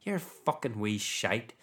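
swearing in a put-on Belfast accent. Demonstrating Belfast as a top swearing city, put-on Belfast accent. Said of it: "I'd arguably go Belfast one".

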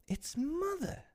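very high, soft squeal; excited cry. A narrator's reading. Wendy peeping at her mother, excited. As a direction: cry out softly in a tiny high voice. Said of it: "cried Wendy".

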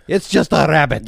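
high, loud film-quote cry; mock exclamation. Co-host quoting the Holy Grail rabbit, mock exclamation. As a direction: call it out in hammy disbelief.

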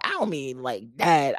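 normal talking voice

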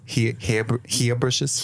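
mock Australian accent; light put-on Australian accent. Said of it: "you're doing an Australian accent".

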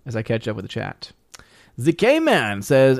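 ordinary talk, nothing marked

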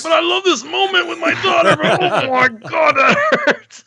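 mock pained dad. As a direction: squeal through the pain, torn but doting. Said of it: "But I'm like".

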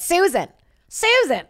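high, drawn-out mock call of "Susan"; mocking name. Comic mocking the name Susan, high teasing call. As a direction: sing "Susan" high to make fun of it.

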